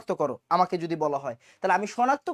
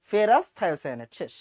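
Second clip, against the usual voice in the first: ordinary delivery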